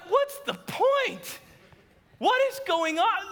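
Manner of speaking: high-pitched, drawn-out mock protest; exasperated. Husband protests to wife about tidying for guests, exasperated. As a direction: whine it as a baffled, put-upon husband.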